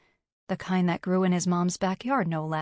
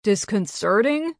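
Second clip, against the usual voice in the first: louder questioning interjection; interjecting; 3 semitones higher, 6 dB louder than usual. A narrator's reading. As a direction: butt in with a suggested word. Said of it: "the male voice interjected".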